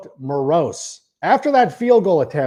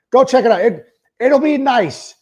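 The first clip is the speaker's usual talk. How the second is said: higher, louder push; hyped pitch; 8 semitones higher, 3 dB louder than usual. Acted. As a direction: sell it hard. Repeating the ad pitch, pushed.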